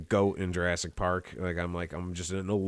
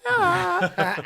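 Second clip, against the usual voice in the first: high sing-song flirty voice; flamboyant guy; 7 dB louder, much slower than usual. Retold or imitated. Stranger on Paris street greets him, high and flirty. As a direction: camp falsetto greeting from a stranger. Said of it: "he goes".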